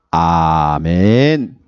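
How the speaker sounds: long, low sung-out amen; drawn-out amen. Real, not acted. Pastor closing the reading with a long low amen. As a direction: stretch the amen very slowly and low.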